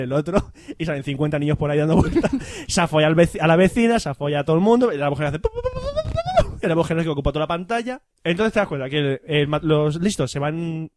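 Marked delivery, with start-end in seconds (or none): 5.6-6.5 very high rapid pu pu pops; popping sounds. Retold or imitated. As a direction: imitate popping bubbles. Árbol genealógico multiplicándose. (imitación de ruidos de pop muy agudos)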